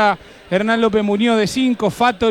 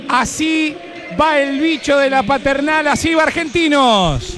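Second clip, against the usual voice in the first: raised, drawn-out announcer call; hyped team intro; 4 semitones higher than usual. Acted. Presenting the home team to the radio audience, announcer call. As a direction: sell the team like a stadium announcer. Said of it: "Con la presentación de [name]".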